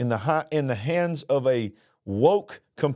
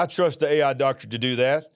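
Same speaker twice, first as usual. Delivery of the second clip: ordinary talk, nothing marked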